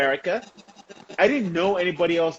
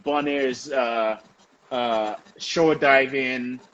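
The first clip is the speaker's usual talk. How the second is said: ordinary talk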